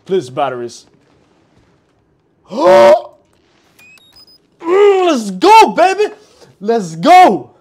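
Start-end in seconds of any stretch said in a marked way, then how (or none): 2.5-3.1 loud high startled gasp; sharp gasp. Real, not acted. Startled gamer gasping loud and high at a sudden scare. 4.6-7.6 loud, high triumphant cheer; excited cheer. Real, not acted. Relieved gamer cheering loudly at a find.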